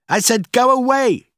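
high, loud, exasperated shout; angry shout. A narrator's reading. Boss sending Rachel away from his office, angry shout. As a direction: yell it insistently through the door.

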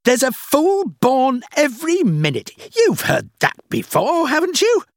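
scornful rant. A narrator's reading. Boss boasting to Rachel that people are fools, scornful. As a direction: stretch the key words with loud contempt. Boss rants about foolish customers.

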